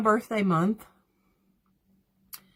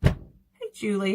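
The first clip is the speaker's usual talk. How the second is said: ordinary delivery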